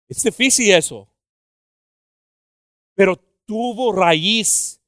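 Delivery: loud, high preaching; impassioned preaching. Acted. Preacher telling the brother's story to the church, impassioned. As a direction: drive the testimony home.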